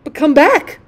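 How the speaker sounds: high, loud call rising at the end; narrated scream. A narrator's reading. Rocket calling after the departing duck, loud high cry. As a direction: cry out after someone leaving. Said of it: "screamed the rocket".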